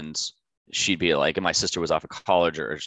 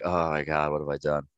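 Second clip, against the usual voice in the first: mock regret; 4 semitones lower, 5 dB quieter than usual. Sunday night regret.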